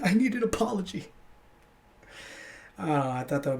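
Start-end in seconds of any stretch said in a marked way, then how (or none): 0.0-1.1 high, whiny put-on voice; mock-wounded whine, pitched way up; mock whining. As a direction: play the crybaby demanding amends. Callback to his mock whine.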